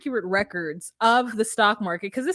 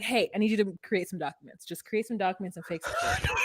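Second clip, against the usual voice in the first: conspiratorial hush; 5 semitones lower, 7 dB quieter, faster than usual. Retold or imitated. Bernie instructs aide to fake.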